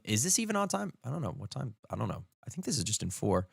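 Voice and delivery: quietly puzzling over the time signature; muttering to himself. Real, not acted.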